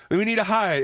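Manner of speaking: high, urgent imitation of panic; mock freak-out. Woman urging her friend to hide, mock freak-out. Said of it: "the woman's freaking the fuck out".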